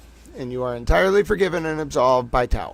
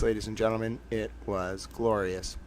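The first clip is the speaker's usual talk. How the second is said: hushed dramatic; 4 semitones lower, 10 dB quieter than usual. Pretend. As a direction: savour it, almost a murmur. Repeats the line for effect.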